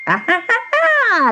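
very high cackling laugh; witch's cackle. A narrator's reading. Witch scorns the girl's offers, high cackle. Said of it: "laughed the old woman".

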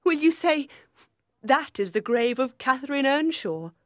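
bitter, high-voiced questioning. A narrator's reading. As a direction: reproach him with wounded bitterness. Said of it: "she said bitterly".

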